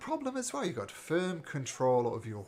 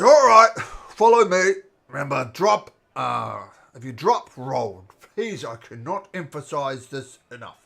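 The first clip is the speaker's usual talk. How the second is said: loudly voiced orc guide; 2 semitones higher, 14 dB louder than usual. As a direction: bellow orders like an orc leader. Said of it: "So the orc says".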